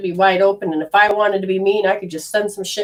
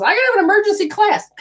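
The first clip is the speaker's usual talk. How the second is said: put-on girls demanding class; 11 semitones higher, faster than usual. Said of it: "These girls go".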